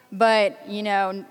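ordinary talk

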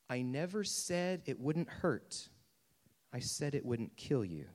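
slow, soft, measured angel voice; calm, firm angel. Acted. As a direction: answer evenly, unmoved by the panic.

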